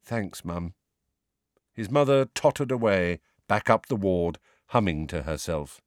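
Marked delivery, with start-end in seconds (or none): 0.0-0.7 softly, under the breath; quiet. A narrator's reading. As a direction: say it barely above a murmur. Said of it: "Neville said quietly".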